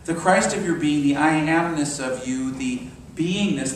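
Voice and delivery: usual speaking voice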